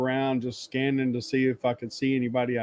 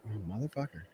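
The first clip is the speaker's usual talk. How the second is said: muttered curse; 4 semitones lower, 14 dB quieter than usual. Retold or imitated. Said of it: "It's like".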